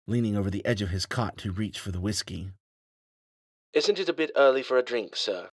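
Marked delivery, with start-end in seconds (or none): 3.7-5.5 smooth, higher, quick English robot butler; a dry English AI teasing his master; light English accent. A narrator's reading. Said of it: "smooth voice with a light English accent".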